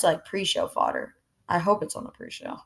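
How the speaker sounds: ordinary talk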